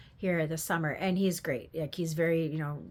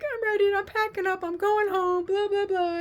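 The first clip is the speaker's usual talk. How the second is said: fed-up whine; 13 semitones higher, 5 dB louder than usual. Retold or imitated. Fed-up mom quits packing.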